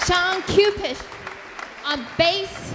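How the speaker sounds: loud high stage announcement; calling out. Real, not acted. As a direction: call the names out over the crowd. Calling out band members to audience.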